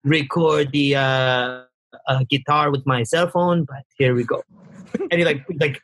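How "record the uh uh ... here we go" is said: low, hesitant French Tunisian voice; French Tunisian accent. Retold or imitated. Designer introducing his phone guitar recording, French Tunisian accent. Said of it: "That sounds French Tunisian".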